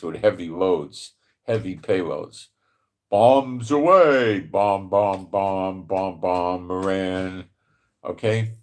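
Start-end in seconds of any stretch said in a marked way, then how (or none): 3.1-6.7 mock bombing run. Mimicking bombs falling.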